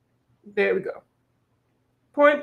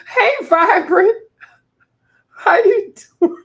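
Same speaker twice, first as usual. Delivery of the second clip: excited greeting; 11 semitones higher, 10 dB louder than usual. Real, not acted. Greeting viewer in high cry.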